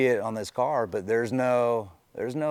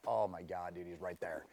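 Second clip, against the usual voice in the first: much softer, hushed, under his breath; hushed disbelief; 2 semitones lower, 12 dB quieter than usual. Retold or imitated. A driver recalling spotting the rival right there, in a hushed voice. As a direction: drop to an awed hush. Retold awed realization in race.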